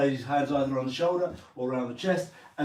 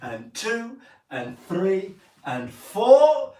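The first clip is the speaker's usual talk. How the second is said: slow count, higher and louder; slow dance count; 6 semitones higher, 5 dB louder, much slower than usual. Shown as a demonstration. Teacher counting the ladies in, slow and lifted. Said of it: "So here we go, ladies".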